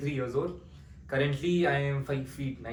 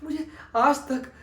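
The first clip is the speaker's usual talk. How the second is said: higher, louder anguished pleading, building; pained outburst; 12 semitones higher, 7 dB louder, faster than usual. Acted. Ved crying out that he is not happy in his uncle's house, anguished. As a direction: pour out the hurt of an orphan. Emotional climax of audition monologue.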